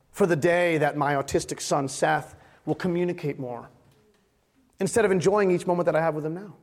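ordinary talk, nothing marked

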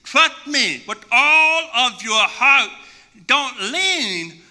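slow, solemn divine reassurance, a lightly voiced God; God's voice. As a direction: speak as God, calm and unhurried. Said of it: "God said".

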